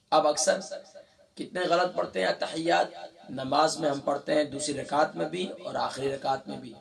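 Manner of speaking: usual speaking voice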